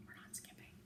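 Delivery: soft conspiratorial whisper. Real, not acted. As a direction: whisper it like a secret. Aside to listeners while reading.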